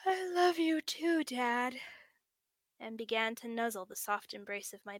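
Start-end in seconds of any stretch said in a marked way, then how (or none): 0.1-1.8 sleepy words stretched through a yawn. A narrator's reading. Sleepy son yawning goodnight to his father. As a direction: say it mid-yawn, drifting off. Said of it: "through a long, drawn-out yawn".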